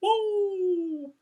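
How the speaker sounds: rising whoa tumbling down; high whooping waterfall cry. Acted. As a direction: whoop like water going over a falls. Waterfall sound.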